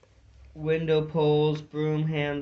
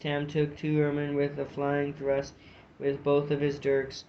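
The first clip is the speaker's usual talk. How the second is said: usual speaking voice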